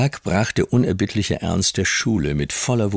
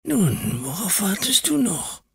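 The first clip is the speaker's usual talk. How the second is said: squeaky, much higher whisper; piping whisper. A narrator's reading. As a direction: pipe it up high and thin. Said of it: "piepste die Flüsterstimme".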